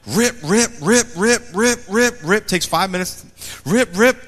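high, loud rip rip; excited ripping. Acted. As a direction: tear into presents with glee. Child tearing open presents.

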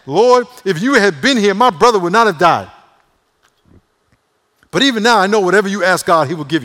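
high, very loud sassy reproach; attitude. Acted. Martha confronting Jesus over her brother, with attitude. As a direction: act her out neck-rolling and indignant, at full volume. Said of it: "with an attitude, rolling her neck".

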